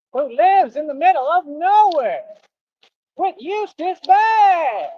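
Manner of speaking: high, drawn-out announcer wail; cartoon show intro. Reciting the cowardly dog show's opening, high hammed-up announcer. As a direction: belt out the intro high, stretching the key words.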